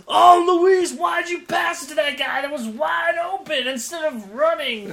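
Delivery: high, loud, exasperated fan yell; mock sideline commentator. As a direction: imitate a fan scolding a player. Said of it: "all the things I've heard from people commentating".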